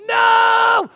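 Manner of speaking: high, very loud wail of "no"; hammed-up frustrated yell; exasperated shout. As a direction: cry out in despair. Exasperated dad's reaction, acted.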